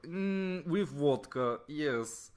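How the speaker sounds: loud, high, slow mock Russian accent; hammed-up Russian robot accent. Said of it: "This is my really offensive Russian voice".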